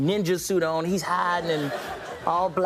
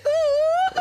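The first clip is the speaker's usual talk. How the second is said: attention-getting ooh; 7 dB louder than usual. Retold or imitated. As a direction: falsetto ooh to catch someone's ear. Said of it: "trying to get my attention".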